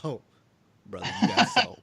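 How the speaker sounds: loud retold crowd-style exclamation. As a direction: call it out loud, as if back at the show. Quoting himself at the concert.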